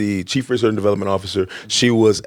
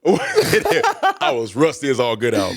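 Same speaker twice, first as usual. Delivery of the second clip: laughing; 3 semitones higher, much slower than usual. Real, not acted. Laughing about being rusty.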